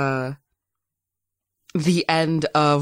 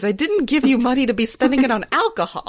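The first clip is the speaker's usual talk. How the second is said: higher, fast scolding voice; Loic voice; 8 semitones higher, faster than usual. Retold or imitated. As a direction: rattle off a quick higher-pitched scolding. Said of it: "Loic voice".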